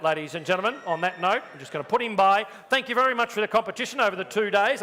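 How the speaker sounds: ordinary delivery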